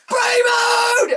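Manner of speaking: high squealing shout of Brie mode; mock Brie yell. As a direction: scream it like an overexcited wrestler. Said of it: "Brie stands there and goes".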